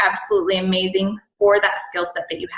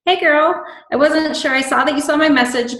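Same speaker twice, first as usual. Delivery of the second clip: bubbly follow-up message; 6 semitones higher than usual. Retold or imitated. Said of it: "I will message back and say".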